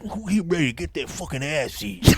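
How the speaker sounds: hushed, put-on comedian voice; imitated stage persona. Comic mimicking a performer on stage, put-on persona. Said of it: "gets up there and he's like".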